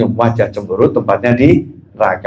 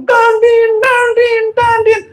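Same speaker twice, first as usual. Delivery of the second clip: very high, insistent mimicked horn; imitated honking; slower than usual. Impatient driver in a jam, shrieking imitated horn. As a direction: honk repeatedly in a high voice.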